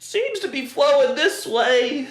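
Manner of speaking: high, loud, drawn-out mock storm watcher; Twister movie character. Host imitating people from Twister out looking at puddles, high-pitched hammy call. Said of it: "We looked like the people from Twister".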